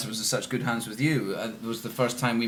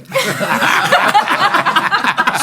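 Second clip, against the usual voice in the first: loud, high burst of laughter; big laugh; 11 dB louder than usual. Real, not acted. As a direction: let out a big delighted laugh. Hearty laugh at director.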